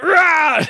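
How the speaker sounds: short, high, loud "rah"; hammed-up battle yell; mock effort roar. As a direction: roar as if heaving on a rope. Acting out his effort yell.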